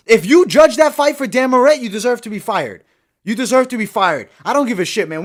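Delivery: high, loud outraged disbelief; pissed off. Real, not acted. Fight fan raging at judges after a bad decision, pissed off. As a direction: rage incredulously at the call. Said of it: "I'm pissed right now".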